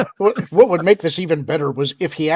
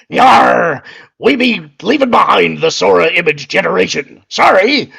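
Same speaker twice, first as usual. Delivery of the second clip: put-on pirate accent; 2 semitones higher, 7 dB louder than usual. Co-host imagines the tech boss dressed as a pirate, loud pirate accent. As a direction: bellow it like a mock pirate, starting on a big yar. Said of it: "speaking in a pirate accent".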